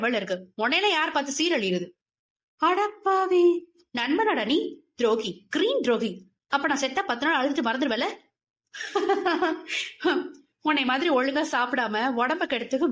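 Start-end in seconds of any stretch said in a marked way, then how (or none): 2.6-6.3 mock outraged. 8.7-10.3 high laugh falling away; burst of laughter. Acted. Laughing on phone.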